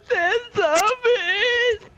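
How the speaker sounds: high, loud, drawn-out wail; panicked cry. Acted. Friend warning a gamer about zombies, panicked. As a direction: slowly wail it in acted terror. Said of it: "You say it like that".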